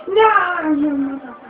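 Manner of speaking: high, loud raging curse at the game; real gamer rage shout; furious yell. Real, not acted. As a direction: explode at the screen. Raging as he fails level.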